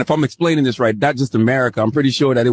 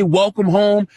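higher, welcoming imitation of a host; imitating Ghanaian hosts; 8 semitones higher, slower than usual. Ghanaians greeting visitors home, imitated higher and welcoming. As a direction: lift it up like greeting family at the door. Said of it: "when they say".

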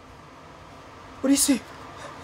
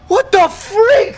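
slow, higher, louder alarmed cry; startled outburst; 9 semitones higher, 9 dB louder, much slower than usual. Real, not acted. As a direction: caught off guard and yelping it out. Startled by dog hiding.

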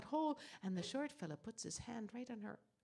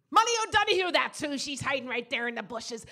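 higher and louder, tattling; loud reveal; 3 semitones higher, 15 dB louder than usual. Acted. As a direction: shout out where she hides. Short man gives her away.

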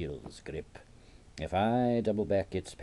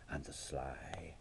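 hushed, sneaky aside; sly whisper; 9 dB quieter than usual. Acted. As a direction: drop to a conspiratorial whisper. Confides secret sabotage of flowers.

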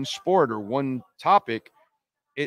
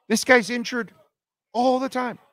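high-pitched exasperation; exasperated; 10 semitones higher than usual. Real, not acted. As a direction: throw up your hands, pitched high. Exasperated about the injured player.

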